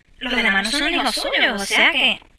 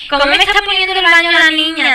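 high, loud shocked complaint; outraged at the mess; exasperated high outcry; indignant surprise; 6 semitones higher, 5 dB louder than usual. Real, not acted. Bathroom flooded by the girl.

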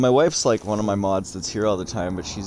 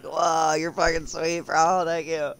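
high-pitched gushing gratitude; reenacted delighted thanks; 9 semitones higher, slower than usual. Streamer retelling his thanks for a donation, high excited gratitude. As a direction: gush thanks in a much higher voice.

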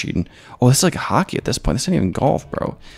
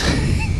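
sudden squealing laugh, high and breathy; high shrieking laugh. Real, not acted. Gamer surprised by old Roblox mini golf, shrieking laugh. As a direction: let out a shrieking burst of laughter.

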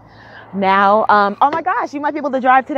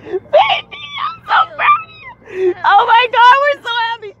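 tearful joy; 6 dB louder than usual. Real, not acted. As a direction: cry out your pride in her. Mom praising daughter after passing.

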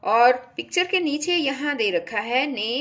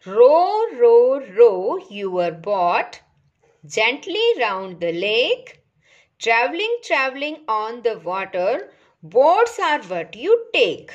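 put-on slow, measured poem reading for children; poem recitation; 4 dB louder, much slower than usual. Shown as a demonstration. Teacher reads a poem to young pupils, slow recitation. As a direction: recite it line by line, drawing out the words.

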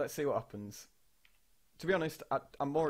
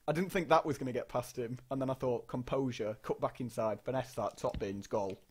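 ordinary talk, nothing marked

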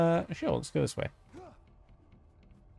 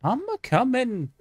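sing-song call; 12 semitones higher than usual. Pretend. Calling out playfully to the game.

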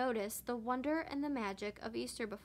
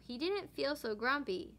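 ordinary delivery, nothing marked

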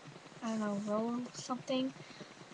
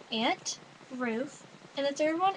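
usual speaking voice

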